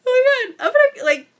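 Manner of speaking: high, loud, real happy crying; tearful, overwhelmed. Real, not acted. Recipient overwhelmed by a friend's gift, breaking into happy tears. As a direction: voice leaping up as the tears come. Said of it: "like, cry right now".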